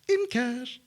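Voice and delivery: high-pitched mock catchphrase; Basher impression. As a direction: quote it gleefully in a hammed-up character voice. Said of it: "I wanna start saying that all the time".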